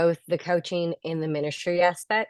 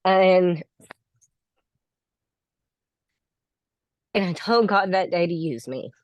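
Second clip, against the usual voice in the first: real emotion, halting with long pauses; fighting tears, stopping to hold it together; choked up. Real, not acted. Graduate thanking her coaching group, trying not to get emotional, choked up. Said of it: "I get emotional".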